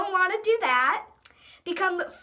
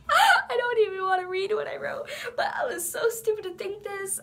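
cringing whine; 5 semitones higher than usual. Real, not acted. Cringing at own old writing.

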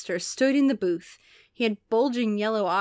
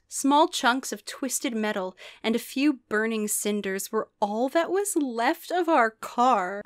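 ordinary delivery

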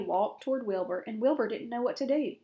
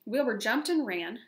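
plain reading voice

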